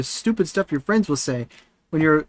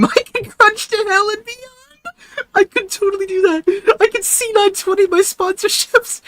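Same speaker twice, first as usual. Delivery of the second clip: laughing; 6 dB louder than usual. Real, not acted. Joking about a crunchy mic.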